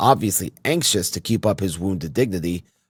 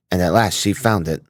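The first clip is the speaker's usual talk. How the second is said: ordinary delivery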